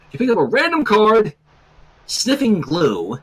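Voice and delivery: ordinary talk, nothing marked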